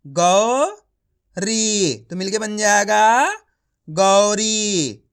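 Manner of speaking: गौरी drawn out slowly, one sound at a time, then said whole; teacher sounding out गौरी letter by letter; syllable by syllable. Shown as a demonstration. Teacher spelling a word aloud slowly for children. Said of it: "मैं किस तरीके से एक एक अक्षर का उच्चारण करके पढ़ रहा हूं".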